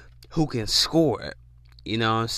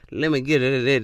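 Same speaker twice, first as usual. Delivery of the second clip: ordinary delivery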